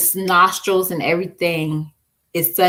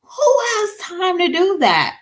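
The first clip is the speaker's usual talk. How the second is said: incredulous question; 12 semitones higher than usual. Retold or imitated. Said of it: "and I was like".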